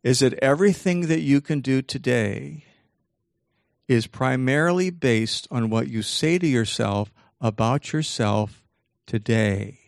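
slow, deliberate emphasis with long pauses; measured phrases, landing separately on the last word; really slow. Shown as a demonstration. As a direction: spell out the key point so nobody misses it. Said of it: "I'll say it really slowly".

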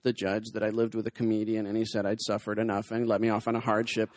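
low, mock-solemn testimony; impersonated long-suffering woman, low and deadpan; doing her voice. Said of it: "I'm gonna have to do the voice".

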